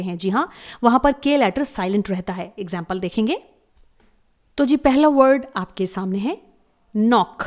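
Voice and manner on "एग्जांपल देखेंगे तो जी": ordinary talk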